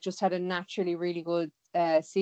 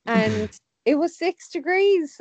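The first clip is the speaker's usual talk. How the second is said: surprised; 9 semitones higher, 5 dB louder, slower than usual. Real, not acted. Surprised at how warm the water was.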